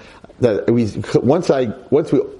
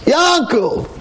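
booming voice of God. Acted. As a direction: call his name like God from the heavens. God calling again.

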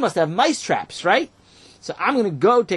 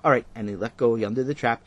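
usual speaking voice

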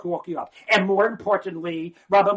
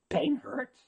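ordinary talk

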